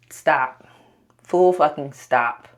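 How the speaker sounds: loud, firm full stop; stern self-command. Real, not acted. Vlogger scolding herself to stop. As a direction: snap herself back into focus. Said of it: "lock the fuck in".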